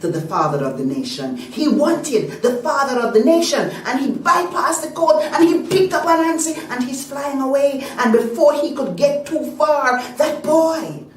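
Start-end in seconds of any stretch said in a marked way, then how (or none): 1.4-11.0 loud, high, rushing excitement; breathless action narration at full voice; urgent storytelling. A narrator's reading. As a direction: build the drama of a sudden snatching. Narrates the bird snatching Anansi away.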